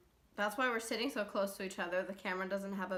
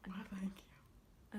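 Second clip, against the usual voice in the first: whisper; 13 dB quieter, much slower than usual. Acted. Mock-gracious reply to "you smart.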